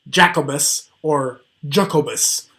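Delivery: Jacobus twice, each wrongly stressed, first syllable punched; mispronounced name. Shown as a demonstration. Teacher mocks silly, inaccurate readings, Jacobus deliberately mis-stressed. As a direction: botch Jacobus two silly ways, hitting the first syllable. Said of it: "so that we get Jacobus and not".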